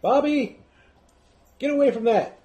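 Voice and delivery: put-on loud sharp call to a child; calling out sharply. Mother calls Bobby away from something, loud. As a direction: shout him away from it.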